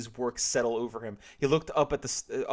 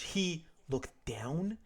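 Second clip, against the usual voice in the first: stunned; 3 semitones higher, 6 dB quieter, much slower than usual. Acted. Sharon slow stunned question.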